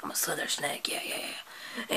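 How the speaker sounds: hushed snake showing off; whispery snake. Acted. Snake puppet showing off his colors to viewers, whispered.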